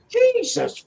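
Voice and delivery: high, loud incredulous outburst breaking into laughs; outraged disbelief. Real, not acted. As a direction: rant in shocked outrage, half laughing. Outraged rant about someone's nerve.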